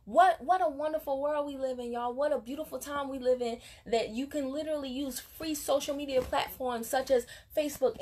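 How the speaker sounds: normal talking voice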